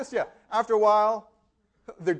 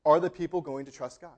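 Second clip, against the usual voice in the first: normal talking voice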